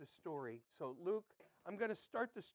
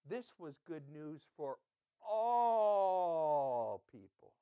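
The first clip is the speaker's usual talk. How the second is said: "all people" stretched out slowly and loudly; stress on all; 9 dB louder, much slower than usual. Shown as a demonstration. Preacher hammering "all" home to the congregation, slowly and loudly. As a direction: lean hard on "all", drawing it out. Pastor stresses the key phrase.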